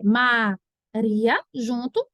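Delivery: "Maria" as ma, then ria in one piece; joined ending. Shown as a demonstration. Teacher showing the class the joined option, two slow syllables. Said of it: "como é que eu sei se Maria é".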